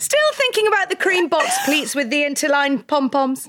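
giggling while saying it; laughing. Real, not acted. Repeats line while laughing.

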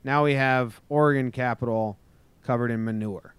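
ordinary talk

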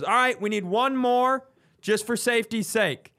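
a brisk set director redirecting the actors; director notes. Retold or imitated. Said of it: "the director goes".

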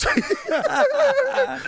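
gloating laugh. Retold or imitated.

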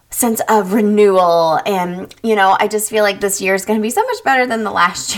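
ordinary talk

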